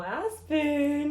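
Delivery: high, drawn-out coo of the dog's name; calling the dog. Real, not acted. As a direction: call your dog in a high voice.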